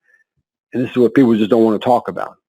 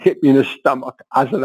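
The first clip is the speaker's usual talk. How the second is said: ordinary talk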